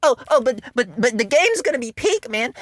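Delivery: high, loud whiny fanboy voice; high squeaky mock fan; mocking fake fans. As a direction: parody defensive fans pleading. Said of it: "fake Zelda fans going".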